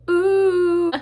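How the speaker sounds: loud teary ooh; emotional ooh. Real, not acted. Overwhelmed by happy tears.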